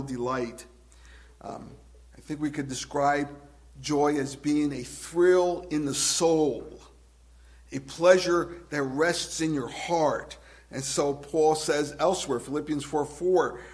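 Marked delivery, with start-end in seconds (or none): 3.8-10.4 slow savoring. Acted. Describing joy slowly.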